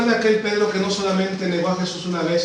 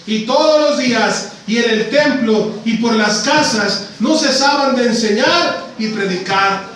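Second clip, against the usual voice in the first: louder, jubilant pulpit proclamation; preaching at full force; 5 semitones higher, 5 dB louder than usual. Acted. Preacher telling the congregation the apostles rejoiced, full preaching voice. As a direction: proclaim it with triumph.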